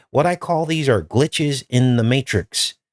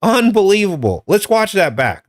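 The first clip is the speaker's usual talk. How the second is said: loud, high disbelief; stunned; 7 semitones higher, 4 dB louder than usual. Real, not acted. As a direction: blown away, can't believe it. Stunned by clip coincidence.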